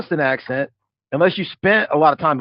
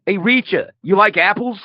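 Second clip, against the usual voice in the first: higher-pitched Boston accent impression; mock Boston accent; 5 semitones higher than usual. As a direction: tease it out in a joke Boston accent. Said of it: "with that accent. She's gonna be like".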